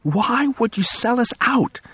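high, slow, tearful plea; hurt, close to crying; on the verge of tears. Acted. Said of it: "on the verge of tears".

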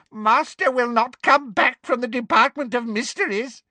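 high gleeful house-elf squeak. Acted. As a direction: gloat like a sly elf. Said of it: "he said gleefully".

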